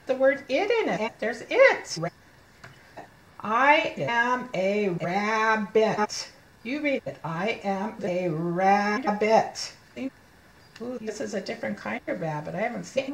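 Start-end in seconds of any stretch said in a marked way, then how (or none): 3.4-6.3 very slow, rabbit split into syllables; slow model reading. Shown as a demonstration. Teacher reading rabbit page to children, very slow word-by-word. 7.2-9.7 slow read-along, rabbit in two pieces; slow model reading. Shown as a demonstration. Teacher prompting child to read, slow word-by-word.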